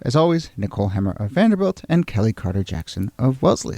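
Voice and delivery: ordinary talk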